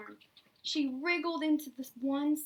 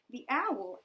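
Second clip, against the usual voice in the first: usual speaking voice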